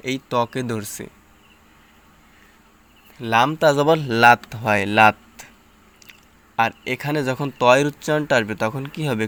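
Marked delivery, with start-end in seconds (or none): none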